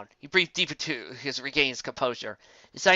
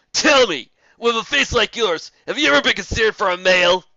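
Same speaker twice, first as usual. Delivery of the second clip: loud, higher mocking griffin; loud sneering jab; 8 semitones higher, 9 dB louder than usual. A narrator's reading. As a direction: hurl the question to get a laugh.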